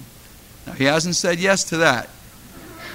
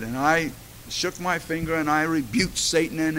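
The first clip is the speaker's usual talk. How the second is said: ordinary talk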